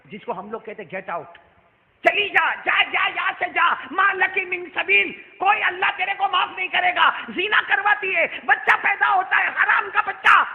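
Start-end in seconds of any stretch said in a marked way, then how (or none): 2.1-10.4 furious high-pitched tirade; enraged full-voice condemnation; angry shouting. Retold or imitated. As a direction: drive the sinner away, yelling every phrase. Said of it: "ابو ہریرہ غصے میں کھڑے ہوئے".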